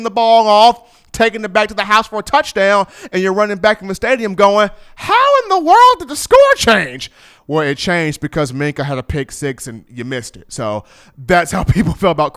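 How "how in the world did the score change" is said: much higher, louder, disbelieving cry; shocked fan. Retold or imitated. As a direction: squeal in disbelief at the scoreboard. Fan missing the touchdown.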